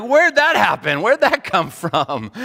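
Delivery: high, loud, bewildered cry; startled disbelief. Retold or imitated. Pool owner after a monsoon wrecks the yard, startled. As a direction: sound caught totally off guard. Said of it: "And you're like".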